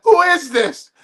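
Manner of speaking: high-pitched, baffled question; self-mocking imitation. Comic imitates himself asking the caller, baffled. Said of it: "I sounded like".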